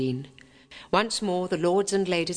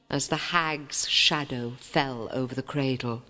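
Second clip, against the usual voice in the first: usual speaking voice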